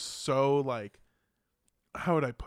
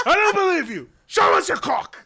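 very loud, high-pitched retold outburst; hammed-up shouting impression, full volume; mock yelling; 13 semitones higher, 9 dB louder than usual. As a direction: bellow it as an outraged British friend. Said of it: "he started yelling".

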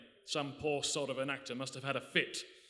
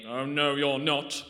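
menacing; 7 dB louder, slower than usual. Acted. Pulls a gun on Legs.

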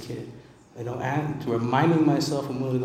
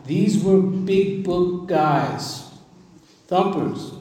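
each word drawn out slowly and higher; big book guys, stretched right out; slow and weighty; 6 semitones higher, 4 dB louder, much slower than usual. Shown as a demonstration. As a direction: savor every word of it slowly. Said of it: "I'm gonna say that one more time".

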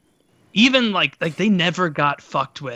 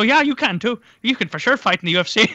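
mock officials agreeing; 7 semitones higher, faster than usual. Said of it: "And they go".

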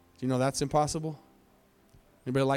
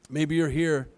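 normal talking voice

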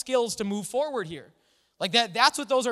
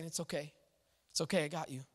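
much softer and lower, a hushed embrace; God soothing an upset child, very softly; tender reassurance; 4 semitones lower, 9 dB quieter, faster than usual. Retold or imitated. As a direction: murmur it like a father holding a child.

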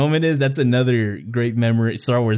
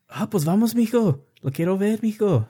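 mom in Spanish; 8 semitones higher than usual. Retold or imitated. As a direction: voice your eager mom saying let's go. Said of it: "It's like".

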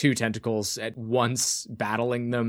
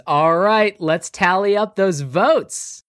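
game show host; 8 semitones higher, 6 dB louder, slower than usual. Acted. Host announcing from drone.

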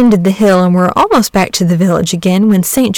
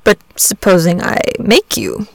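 ordinary delivery, nothing marked

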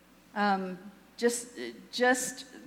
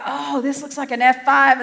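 louder, higher awed exclamation; marveling; 4 semitones higher, 8 dB louder than usual. Retold or imitated. Movie scientists looking at a tornado, marveling. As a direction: marvel at it out loud. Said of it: "scientists who are marveling".

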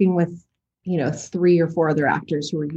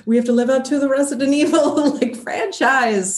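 higher, quickened mock worry; imitated pressured actors; 6 semitones higher than usual. Actress voicing what the cast avoided thinking, imitated pressure. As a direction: act out pressure, rushing then stretching the end. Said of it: "instead of being like".

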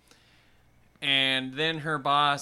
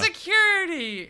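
acted shrieking summons; calling for security; 6 dB louder than usual. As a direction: call out for the guards in mock panic. Acting Christine calling for guards.

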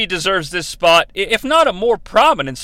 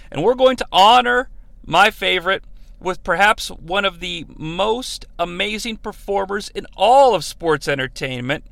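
ordinary delivery

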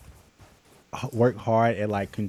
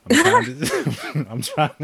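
laughing; 13 semitones higher, 5 dB louder, much slower than usual. Real, not acted. Laughing at her own struggle.

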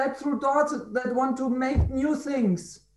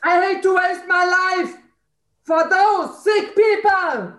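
real angry shout; 6 semitones higher, 4 dB louder than usual. Real, not acted. Participant venting about wasting her life, furious shout. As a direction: hurl out each word. Voicing anger when prompted.

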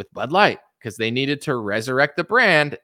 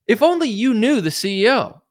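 put-on high, smug [name] parody; mocking [name]; 8 semitones higher than usual. Quoting [name] on Rogan, high smug mockery. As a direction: sneer it up high like a smug know-it-all. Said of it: "[name] goes on the Joe Rogan podcast and was like".

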